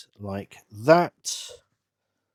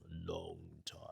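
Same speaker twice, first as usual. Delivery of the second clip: wry murmur; 7 semitones lower, 19 dB quieter than usual. Real, not acted. Married man dwelling on the years, wry murmur. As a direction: let "long" stretch and fade almost to nothing.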